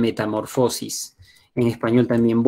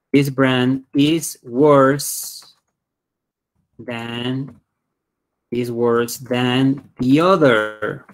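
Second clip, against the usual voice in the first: "this brand is worse than" in drawn-out pieces; slow dictation; much slower than usual. Shown as a demonstration. Teacher building an example for learners, slow dictation. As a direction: draw out "worse" and pause before "than".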